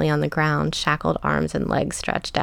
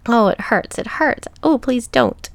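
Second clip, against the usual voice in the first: higher, louder pained pleading; sobbing in pain; 5 semitones higher, 4 dB louder than usual. A narrator's reading. Captive begs her captor to stop, acted sobbing. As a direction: cry out in acted distress, pitch lifted. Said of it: "She was sobbing and crying".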